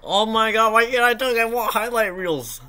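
high, loud frustrated wail; groaning at his missed shot; frustrated outcry. Real, not acted. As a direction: cry out in exasperation. Frustrated at own play.

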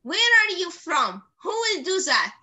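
ordinary talk

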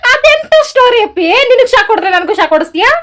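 very high, loud disbelieving question; loud, high-voiced indignation; incredulous protest. Real, not acted. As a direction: challenge his excuse at the top of your pitch. Wife mocks husband's excuse.

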